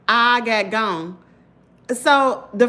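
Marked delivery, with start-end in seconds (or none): 0.1-1.2 I got gone, drawn out slowly, each word weighted; slow emphatic repeat. Retold or imitated. Telling viewers, slowly, how she fled.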